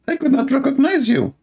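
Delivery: high put-on wife voice, surprised; wife's voice. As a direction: squeal like a startled wife. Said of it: "The wife, she say".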